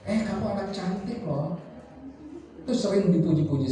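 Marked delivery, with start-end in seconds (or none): 0.0-1.5 higher, softer coaxing praise; soft, high doting voice for a child; sweet praise to a child. Retold or imitated. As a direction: coo praise at a little kid.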